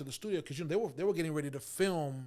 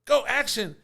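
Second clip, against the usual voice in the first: loud, raised call of action; director's command; 6 semitones higher, 8 dB louder, slower than usual. Retold or imitated. Imitates director starting the scene.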